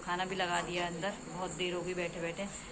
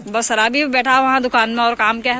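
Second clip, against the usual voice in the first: louder, higher teasing reproach; loud teasing scold; 5 semitones higher, 16 dB louder than usual. Real, not acted. Teasing the shopkeeper about drunkards, loud and high.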